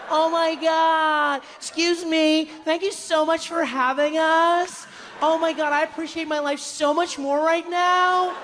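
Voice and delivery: high, drawn-out tourist squeal; mock overexcited tourists in a high voice; gushing tourists. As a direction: gush with long stretched-out final vowels. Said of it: "the people in our tour group were like".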